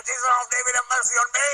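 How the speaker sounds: imitated blind beggar's shout. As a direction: cry out for mercy over the crowd. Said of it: "Dia berteriak".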